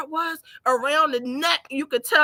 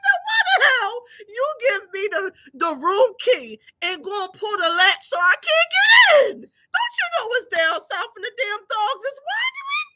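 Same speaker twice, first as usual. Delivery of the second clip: high, loud mock-angry scolding; laughing scolding; 11 semitones higher, 4 dB louder than usual. Scolding her mom about the room latch, laughing. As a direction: tell her off while cracking up.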